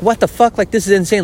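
high, incredulous burst; retold shocked outburst; stunned disbelief. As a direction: react like you can't believe it. Retelling his reaction to schedule.

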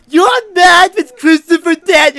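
high, loud fanboy shout; excited fan yell. Retold or imitated. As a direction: blurt it out at the star. Fan yelling at a wrestler.

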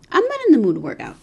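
usual speaking voice

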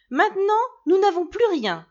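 high, loud angry cry; angry outcry. A narrator's reading. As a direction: cry out in upset anger. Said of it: "s'écria-t-elle".

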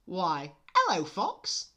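high, loud greeting; high cartoon mouse; chirpy mouse. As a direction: squeak a big hello.

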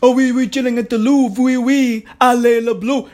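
lightly put-on French accent, quick and smug; mock French accent. Mocking bougie fans at the Louvre in a light French accent. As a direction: poke fun at posh fans at the Louvre. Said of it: "You fucking bougie asses at the fucking Louvre".

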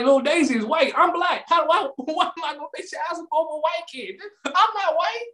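high, protesting disbelief; rising outrage, high voice; mock indignant protest. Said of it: "I'm like".

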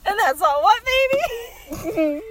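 high, loud put-on character voice; playful character voice, pushed high; new character. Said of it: "slide into a new character".

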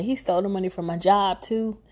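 ordinary delivery

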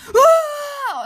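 high, loud scream; sudden full-voiced shriek; Lukas Brawl Stars. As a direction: imitate a screaming YouTuber. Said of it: "Ich bin jetzt mal kurz Lukas Brawl Stars".

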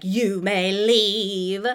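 put-on slow, haughty English-accented dismissal; a grand English accent, drawn out; posh English accent. [name] dismissing Angie in a haughty English accent. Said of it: "[name] drops into an English accent".

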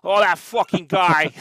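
loud, drawn-out gruff New Yorker; Pat Duffy. Acted. Friend imitating gruff comic shop owner, loud gruff impression. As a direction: bellow it slowly like a gruff New York guy. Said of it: "Pat used to talk like this".

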